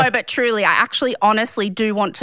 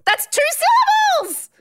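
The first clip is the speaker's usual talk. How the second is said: high squeal of disbelief; incredulous protest; 3 dB louder than usual. Real, not acted. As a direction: wail it in exasperation. Exasperated protest again.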